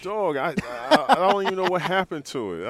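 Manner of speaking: loud, very high protest; exasperated exclaiming. Real, not acted. Player tells host about the lost sweater, exasperated exclaiming.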